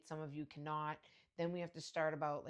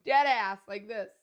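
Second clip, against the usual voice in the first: ordinary delivery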